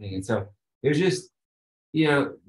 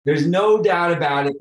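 louder, higher, eager certainty; emphatic excitement; 6 semitones higher, 8 dB louder than usual. Real, not acted. Guest telling the host eagerly there will be another retreat. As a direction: burst out with enthusiastic conviction.

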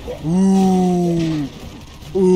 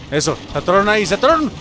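usual speaking voice

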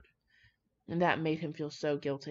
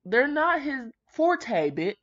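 exasperated; 8 semitones higher, 7 dB louder, slower than usual. Real, not acted. Ranting about Sherlock.

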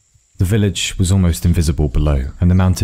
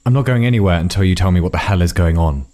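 ordinary delivery

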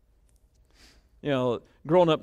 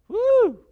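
sudden, much higher whoop; 8 dB louder than usual. Real, not acted. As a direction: cry out in delight at the point. Preacher's own exclamation.

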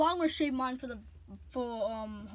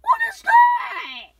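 high, loud, drawn-out outburst; indignant shout; 9 dB louder, slower than usual. Acted. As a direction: shriek it in dismay. Upset over the wrong gift.

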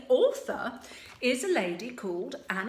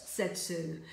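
ordinary delivery, nothing marked

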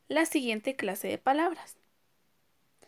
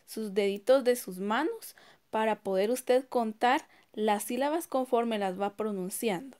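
normal talking voice

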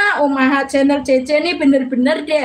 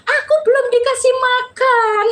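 retold childhood shout; 9 semitones higher than usual. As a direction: call out as a hungry kid yelling for mum. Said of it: "Saya udah teriak-teriak".

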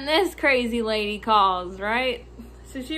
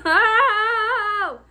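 loud, high exasperated "oh"; venting groan; 9 semitones higher, 7 dB louder than usual. Real, not acted. Worker venting about customers, loud exasperated groan. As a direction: let out a big frustrated cry.

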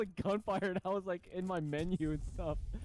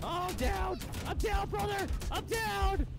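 excited yelling; 11 semitones higher than usual. Real, not acted. Downed in firefight, yelling.

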